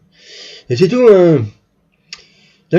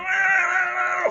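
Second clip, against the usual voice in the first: long, high, softer engine wail; imitated engine whine; 12 semitones higher, 6 dB quieter than usual. Storyteller shows the car racing off, high imitated engine whine. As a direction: mimic the engine pulling away.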